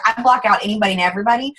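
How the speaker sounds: ordinary delivery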